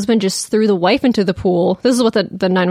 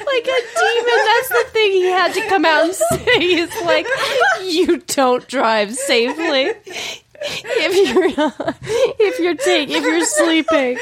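high, giggling, slow words; fighting off laughter; laughing; 9 semitones higher than usual. Real, not acted. Still laughing while quoting.

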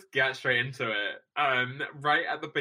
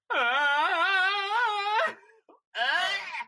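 high drawn-out mock scream; long, high-pitched comic "aah"; mock pained scream. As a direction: cry out as if perfume hit the tongue. Buddy sprays perfume into his mouth.

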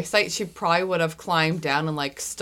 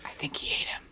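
hushed joking whisper; soft, voiceless side comment; whispered aside; 11 dB quieter, faster than usual. Pretend. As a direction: whisper it under the scene. Aside during a dramatic scene.

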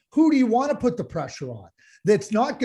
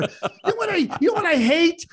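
heated and rising higher; working himself up into real anger; getting mad; 9 semitones higher, faster than usual. Real, not acted. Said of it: "I'm getting mad".

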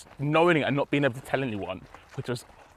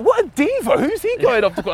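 much higher, teasing colleagues' jab; mock diva jibe; 12 semitones higher, 5 dB louder than usual. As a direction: sneer it like a coworker mocking a diva. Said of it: "They're like".

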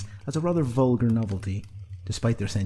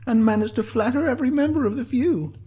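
high-pitched, louder figure voice; Sinead's character voice; 5 dB louder than usual. A narrator's reading. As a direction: pitch it well above the narration.

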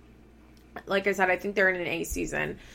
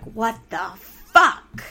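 mock outraged; 2 semitones higher, 6 dB louder, much slower than usual. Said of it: "she's like".

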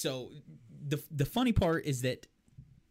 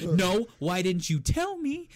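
louder, higher, drawn-out protest; raised, stretched-out mock outrage; mock indignant; 6 semitones higher, 6 dB louder, slower than usual. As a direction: imitate an aggrieved demand, slowly. Repeats retold indignant question.

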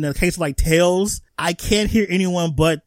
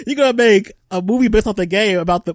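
normal talking voice

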